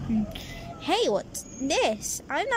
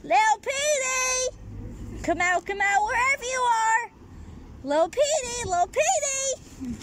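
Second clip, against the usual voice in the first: high sing-song calling for Petey; 8 semitones higher than usual. Acted.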